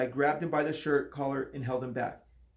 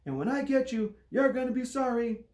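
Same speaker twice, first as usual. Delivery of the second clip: high, tense threatening voice; angry man in a boy's body, threatening; angry threat; 10 semitones higher, 4 dB louder than usual. A narrator's reading. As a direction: threaten him through clenched anger. Angry teacher threatens in dark.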